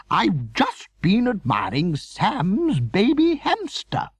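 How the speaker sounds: high, slow gushing lady; Veronica voice. A narrator's reading. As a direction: coo like a fussy aunt. Veronica character voice.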